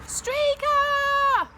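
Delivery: long high call across a field; yelling the dog's name. A narrator's reading. Narrator yells after the vanished dog.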